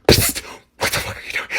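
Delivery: imitated mom whispering across a room; whispered mock scolding; urgent stage whisper. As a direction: hiss it under your breath, scolding. Mom hisses at kid leaving stage.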